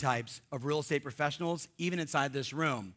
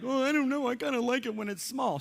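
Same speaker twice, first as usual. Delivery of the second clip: high, much higher whiny voice; higher mock whine of a timid agent; 8 semitones higher than usual. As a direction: mimic a meek small-thinker. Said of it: "some of you are like".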